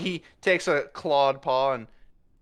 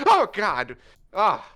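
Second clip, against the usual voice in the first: high, louder startled cry; 6 semitones higher, 4 dB louder, slower than usual. Acted. Puss startled when Yevon drifts up behind him. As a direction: jump as someone sneaks up.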